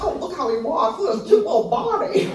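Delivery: gushing over a cute animal; retold baby-talk delight; smitten cooing. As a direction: coo adoringly, pitch climbing.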